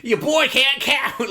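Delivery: hammed-up smug dealer voice; mock boast. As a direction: lay it out slowly like a proud dealer. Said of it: "he was just like".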